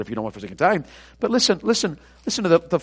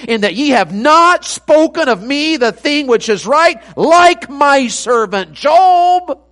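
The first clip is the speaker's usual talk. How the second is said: loud, high, slow pulpit proclamation; preacher proclaiming God's rebuke of Job's friends; full-force preaching; 9 semitones higher, 8 dB louder, slower than usual. Acted. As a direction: drive each word home with power.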